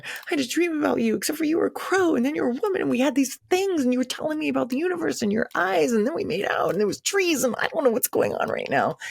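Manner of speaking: high, tearful rush of words; bawling, blurting. Retold or imitated. Telling the shaman about her dream while crying, high and tearful. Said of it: "And I'm bawling and bawling".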